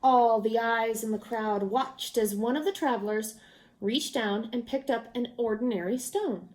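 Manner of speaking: ordinary delivery, nothing marked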